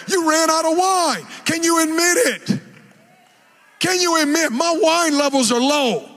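shouted, fervent preaching. Acted. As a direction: call them out from the pulpit. Preaching at full force.

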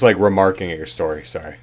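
ordinary talk

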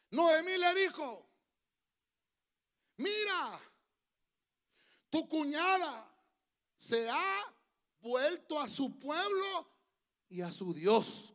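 high, loud, drawn-out proclamation; dramatic scripture reading. A narrator's reading. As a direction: read scripture out phrase by phrase, slowly. Preacher reads scripture aloud. (lectura bíblica solemne y proclamada)

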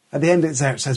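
normal talking voice

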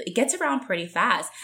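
usual speaking voice

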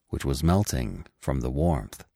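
plain reading voice

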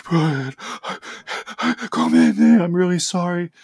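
mock sobbing apology, pitch jumping about; imitated crying. Crying pupil apologizing after the hallway, imitated sobs. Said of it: "and he's crying".

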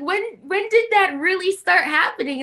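high, loud, amazed friend voice; surprised friends. Retold or imitated. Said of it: "They're like".

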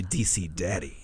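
low, soft and seductive; sultry voice. Shown as a demonstration. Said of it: "say that in a sultry voice".

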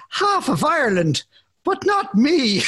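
high, hammed-up Irish trailer line; mock Irish accent. Host quoting the man in the trailer, mock Irish accent. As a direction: lilt it up in a put-on Irish brogue. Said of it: "And he says".

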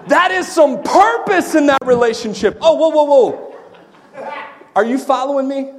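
loud, high, exuberant sermon shout; fired-up preacher whooping it up; impassioned preaching. Real, not acted. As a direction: preach it with full-throated excitement. Preaching at full force.

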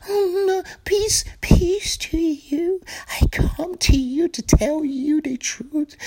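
high, soft, slow breathy whisper of a saintly preacher; mock holy peacemaker. Mocking the soft-spoken peace preacher in a high whispery voice. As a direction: whisper it like a sugary holy man. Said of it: "you have to be like".

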